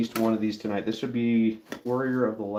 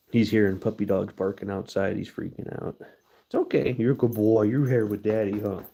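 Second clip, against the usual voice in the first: usual speaking voice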